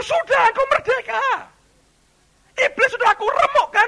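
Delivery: very high, loud proclamation; shouted declaration. Acted. God telling the believer he is free, shouted declaration. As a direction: cry out the victory at full voice. God's answer in shouted exchange.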